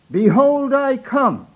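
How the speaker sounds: high, nervous pulpit announcement. Retold or imitated. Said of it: "he announced again".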